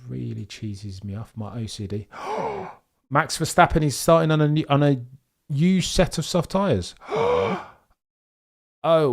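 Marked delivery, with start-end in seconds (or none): 2.1-2.7 quick high gasp in mock shock; high startled gasp; sharp gasp. Real, not acted. Reacts to tyre choice on screen. 7.0-7.6 quick high gasp in mock shock; high startled gasp; sharp gasp. Real, not acted. Reacts to tyre choice on screen.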